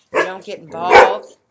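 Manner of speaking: usual speaking voice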